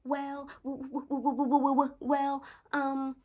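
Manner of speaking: high-pitched flustered stutter; mock stammering. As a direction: stall on the first sound, high and lost for words. Imitates cornered girl stammering.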